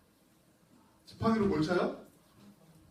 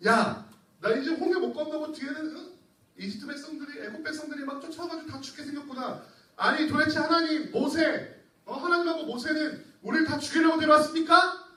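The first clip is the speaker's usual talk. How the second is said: higher, louder whining complaint; grumbling Israelites; 7 semitones higher, 5 dB louder than usual. Acted. Preacher voicing Israelites complaining to Moses, whiny grumble. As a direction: act out the people griping at the Red Sea. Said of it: "이렇게 막 불평불만하면서".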